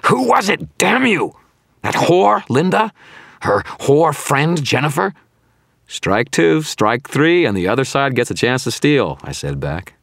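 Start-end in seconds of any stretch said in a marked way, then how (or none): 0.1-5.1 loud, very high furious demand; enraged high-pitched villain yelling; screaming. A narrator's reading. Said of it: "Victor screamed at me". 5.9-9.2 loud, high mocking call; goading, raised-voice jeer; taunting. A narrator's reading. Hero taunts the screaming villain back.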